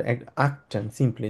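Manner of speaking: আকটেন said slowly with a single t sound; careful pronunciation. Shown as a demonstration. Teacher models how Germans say আকটেন, slowly. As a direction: spell out আকটেন slowly, one t only. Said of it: "একটা টি উচ্চারণ করে".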